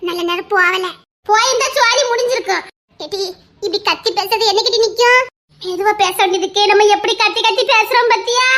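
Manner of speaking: ordinary delivery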